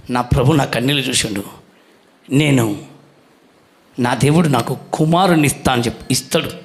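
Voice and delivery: lightly voiced Hannah. Hannah speaking after prayer.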